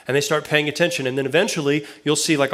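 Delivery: usual speaking voice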